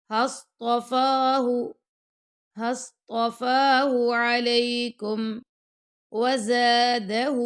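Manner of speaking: slow, measured tajweed chant; Quran recitation. Shown as a demonstration. Teacher reciting the verse to students, slow and melodic. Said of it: "دو second تک فا کو لمبا کریں گے".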